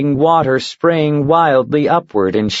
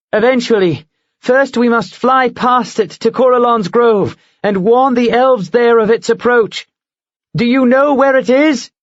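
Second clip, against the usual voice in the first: higher, firm and urgent; determined heroine; 10 semitones higher than usual. Acted. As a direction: steer the eagle toward the grove. Mara explains her plan.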